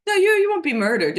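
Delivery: usual speaking voice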